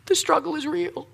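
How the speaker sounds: soft, hammed-up whining, pitch climbing; put-on woe-is-me moan; mock self-pity. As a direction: play the poor struggler feeling sorry for himself. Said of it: "so in self-pity".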